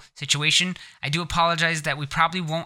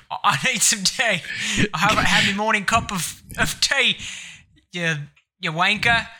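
Cockney accent; 5 semitones higher than usual. Shown as a demonstration. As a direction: stumble through a hammed-up Cockney demand. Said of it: "I could also go with the Cockney one".

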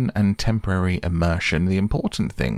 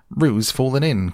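higher, urgent alarmed call; alarmed cry; 7 semitones higher, 4 dB louder than usual. Acted. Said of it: "cried Rabbit".

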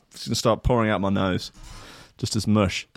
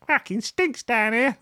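much higher, slower mock woman's voice; mock female colleague; 13 semitones higher, slower than usual. Host imitating a female team member smelling the studio, high mock voice. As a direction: sneer it in a high put-on woman's voice. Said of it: "That's how they speak".

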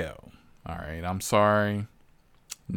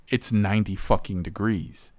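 normal talking voice

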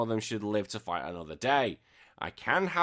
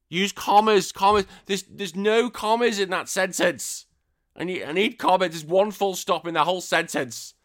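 loud, higher exasperated rant over bad grammar; raised-voice frustrated tirade; angry rant; 7 semitones higher, 6 dB louder than usual. Real, not acted. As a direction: blow up about the missing commas. Ranting about missing commas.

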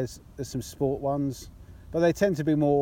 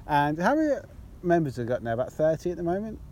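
usual speaking voice

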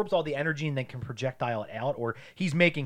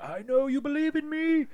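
mock tender encouragement; 13 semitones higher than usual. Mocking Magneto's helper to a friend, high coaxing voice. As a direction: coax him sweetly.

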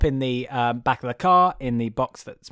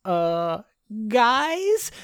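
nervous Buzz; 7 semitones higher than usual. Retold or imitated. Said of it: "Buzz is like".

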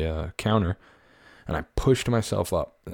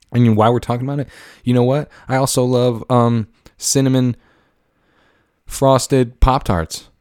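excited; 5 semitones higher, 5 dB louder than usual. Real, not acted. Gushing about favorite Pop-Tart.

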